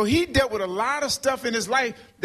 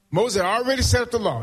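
ordinary talk

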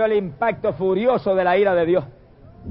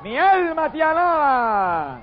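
multitude shouting; 7 semitones higher, much slower than usual. Acted. Preacher voicing the heavenly crowd, slow high shout. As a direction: cry out like thunder from heaven. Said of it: "la multitud del cielo vuelve y grita".

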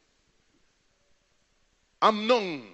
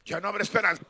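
normal talking voice

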